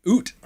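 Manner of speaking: "Oot" with a long oo; exaggerated Canadian "Oot" for out; Canadian vowel. Shown as a demonstration. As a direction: say "Oot" rhyming with boot. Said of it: "it's in the vowels too".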